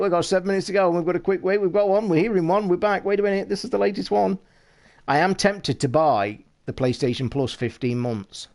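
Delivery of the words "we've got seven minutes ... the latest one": high-pitched, fast mock live updates; frantic deadline coverage. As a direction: rattle it off like a breathless deadline-day reporter. Mimics frantic transfer deadline coverage.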